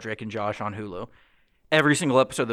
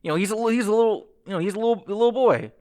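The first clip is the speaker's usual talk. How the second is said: surprised recognition; 9 semitones higher, 4 dB louder than usual. Retold or imitated. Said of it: "I was like".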